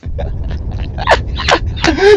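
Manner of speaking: laugh. Real, not acted. Laughs mid sentence.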